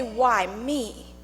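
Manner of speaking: normal talking voice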